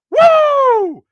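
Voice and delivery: high, loud whoop of celebration; loud excited woo. Real, not acted. Podcaster whoops to his viewers, loud and excited. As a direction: whoop it out like a fan at the stadium.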